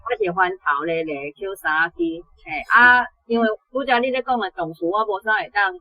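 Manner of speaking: ordinary delivery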